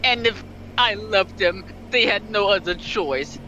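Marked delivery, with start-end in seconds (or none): none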